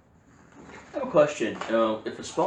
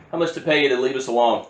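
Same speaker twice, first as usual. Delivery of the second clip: calling out; 3 semitones higher, 7 dB louder than usual. Acted. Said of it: "turn to the rest of them and go".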